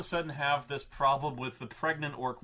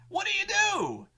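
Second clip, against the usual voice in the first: exasperated shout; 4 dB louder than usual. Real, not acted. Exasperated rhetorical cry.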